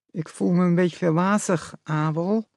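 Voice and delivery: a mother's voice slurred as if numb from the dentist; woozy, lisping mother turned deer. A narrator's reading. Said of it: "lispelde het hert met zijn moederstem".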